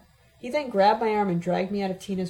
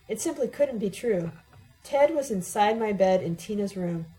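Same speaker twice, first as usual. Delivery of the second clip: ordinary delivery, nothing marked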